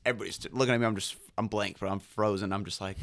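ordinary delivery

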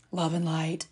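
low, soft mock-spiritual murmur; mock serene. Mocking the fake spiritual crowd, softly and serenely. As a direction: say it like a soft, dreamy platitude.

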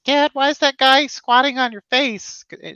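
put-on kid voice. Son's question voiced in story.

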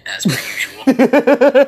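sudden high squeal, drawn out slow; squeaky high mock voice. Mocking Francis, voice jumps high.

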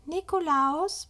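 mother's mock childish squeak; squeaky. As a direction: squeak his name with rising wonder. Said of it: "piepst Mutter".

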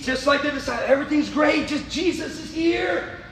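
high, rising preacher's cry; preaching at full force. Acted. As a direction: build the sermon to a fever pitch.

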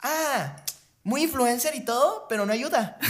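high, slow mock reproach; imitated indignant woman. Agitated señora shaming an influencer who won't help, high and reproachful. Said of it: "una señora así súper a-, alterada".

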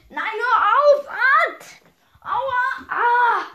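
high, loud acted yelps; pained protest. Nose ring being pulled, loud pained cries. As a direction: cry out in pain and protest.

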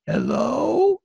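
put-on very high old lady answering; older woman. Older woman answering a stranger's call, high elderly voice. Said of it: "she sounded much older than forty".

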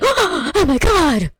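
high gasping mock shock. [name] reading the threatening letter, high shocked gasp. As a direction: act floored by the letter, pitch up.

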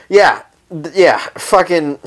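normal talking voice

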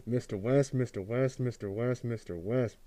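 low, soft repeated name; hushed nudging. Acted. Trying to wake Mister West, hushed and low. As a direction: murmur it evenly, as if rousing a sleeper.

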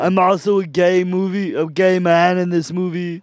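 high mock Austrian accent.

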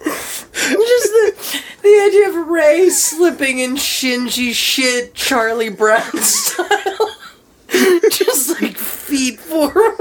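high, wheezing through real laughter; helpless laughter. Real, not acted. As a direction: struggle to speak while cracking up.

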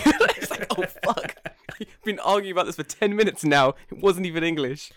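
high, loud, breaking into laughter; laughing exasperation. Retold or imitated. Jordan ends the teacher story, laughing mock exasperation. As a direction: groan the punchline through laughs. Laughs through retold punchline.

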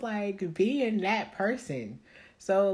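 ordinary delivery

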